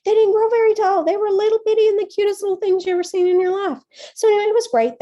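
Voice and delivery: high, loud and fast, bubbling over; excited gushing. Real, not acted. Teacher gushing to her audience about tiny tomato plants, high and loud.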